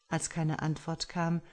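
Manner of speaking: usual speaking voice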